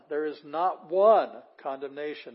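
"one" loud and stretched; stress on NOT ONE. Shown as a demonstration. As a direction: punch "not one" within the sentence. Driving home the literal meaning.

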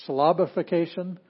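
"Syllabification" with a loud, high punch on the second syllable, LAB; misplaced stress. Shown as a demonstration. Teacher showing students where to hit the word, "Syllabification" stressed on LAB. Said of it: "where do you hit the word".

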